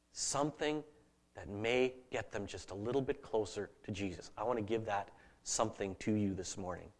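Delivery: low, hushed and heartfelt; gentle pastoral hush; tender, sincere. Real, not acted. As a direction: speak softly to their hurt, leaving space. Preacher turns tender about hurting listeners.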